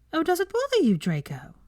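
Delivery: innocent. Acted. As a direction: play innocent to needle him. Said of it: "she asked innocently".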